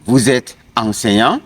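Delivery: "enseignant" lifted at the end; rising question tone. Shown as a demonstration. Teacher inviting students to try it, rising question tone on "enseignant". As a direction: turn it into a question by raising "enseignant". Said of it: "So you can try it".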